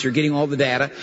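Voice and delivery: ordinary talk, nothing marked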